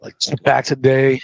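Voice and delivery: hoarse, rough voice; raspy throat from illness; smoker's rasp. Real, not acted. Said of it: "I sound like I've been smoking a pack of cigarettes".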